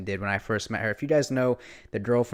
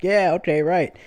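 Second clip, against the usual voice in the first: sarcastic brush-off; 7 semitones higher, 8 dB louder than usual. Retold or imitated. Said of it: "I'm like".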